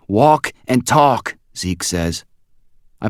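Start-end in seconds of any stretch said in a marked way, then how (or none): 0.1-1.4 higher, louder, slow and deliberate; urgent friend. A narrator's reading. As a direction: say it pressing him to move. Zeke voiced, hurried.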